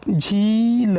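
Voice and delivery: झील with a long, leaned-on ī; long ī held with pressure. Shown as a demonstration. Teacher pointing out the extra pressure, ī in झील drawn out. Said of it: "थोड़ा सा ज़्यादा ही".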